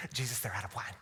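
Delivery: low, quiet aside to Jesus; imagined Mary's tone. Acted. Preacher imagines Mary quietly telling Jesus the wine is gone, hushed. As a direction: lean in quietly, as a mother confiding trouble. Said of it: "hear the tone of her voice".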